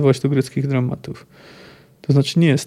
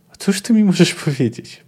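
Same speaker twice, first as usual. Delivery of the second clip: scornful snort; 4 semitones higher than usual. Acted. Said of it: "Parsknął".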